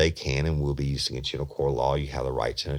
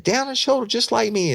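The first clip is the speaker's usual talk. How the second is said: excited; 4 dB louder than usual. Retold or imitated. Suspect's eager reply.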